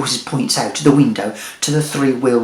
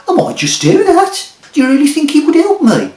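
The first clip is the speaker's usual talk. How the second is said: much higher, louder eager voice; high excited Bob; 13 semitones higher, 6 dB louder than usual. Acted. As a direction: pitch way up, eager and hopeful.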